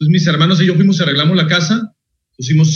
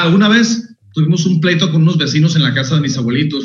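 usual speaking voice

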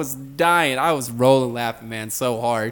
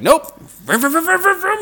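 high, loud vroom vroom engine noises; mock engine revving; 6 dB louder than usual. Teller imitates her car speeding off, loud engine noises.

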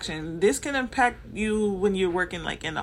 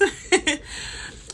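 short airy laugh; a quick rueful chuckle; breathy laugh; 9 semitones higher than usual. Real, not acted. Laughs at own remark.

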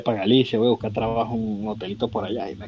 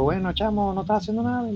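friend's invitation; 9 semitones higher than usual. Retold or imitated. Friend calls him to work.

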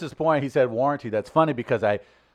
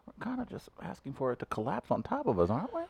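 soft aside; 5 semitones higher, 10 dB quieter than usual. Retold or imitated. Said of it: "I'm just like".

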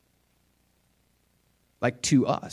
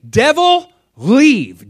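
high, loud command; commanding the devil; 11 semitones higher, 12 dB louder, much slower than usual. Shown as a demonstration. Preacher shows commanding the devil to leave, high and loud. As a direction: cast the devil out with authority. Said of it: "'Cause when you say".

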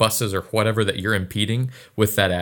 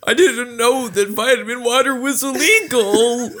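laughing; 4 dB louder than usual. Real, not acted. Joking about vitamin water.

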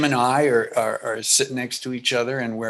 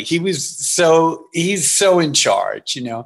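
normal talking voice

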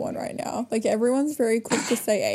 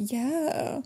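higher, softer, uncertain rising yes; retold confusion; 5 semitones higher, 5 dB quieter than usual. Confused host agreeing with co-host anyway, tentative. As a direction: go along with it, tentatively, voice lifting. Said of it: "really confused".

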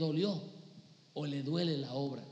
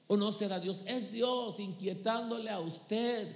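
ordinary talk, nothing marked

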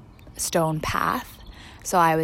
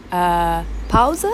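imitating a child; 5 dB louder than usual. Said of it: "She's like".

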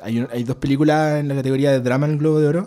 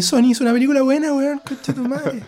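excited; 11 semitones higher than usual. Real, not acted. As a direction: burst out in delighted disbelief. Excited outburst about the movie.

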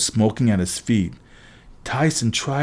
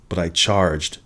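usual speaking voice

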